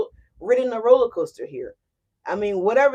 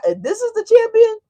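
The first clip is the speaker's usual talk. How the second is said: mock disbelief; 12 semitones higher, 7 dB louder than usual. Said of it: "And you're looking like that".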